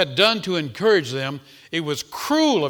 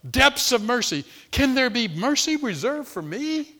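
pleading sinner; 8 semitones higher than usual. Acted. Voicing the hymn writer crying out.

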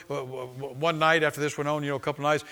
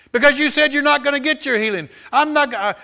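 high, loud acted retort; defiant answer; 10 semitones higher, 6 dB louder than usual. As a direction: answer the devil back forcefully. Hagin answers the devil, retold.

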